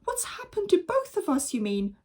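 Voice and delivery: high angry retort; shout. Acted. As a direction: snap it back high. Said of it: "shouted Mister Gregg".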